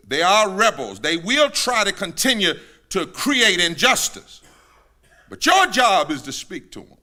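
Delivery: loud, high-pitched sermon climax; full-force preaching. Real, not acted. Preacher driving home Ezekiel's call, full-force preaching. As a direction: cry it out to the congregation.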